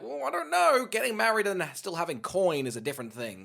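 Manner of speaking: high squeaky mock voice; mocking quote. Mocking a woman's reply in a high voice. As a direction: pitch it way up, mocking.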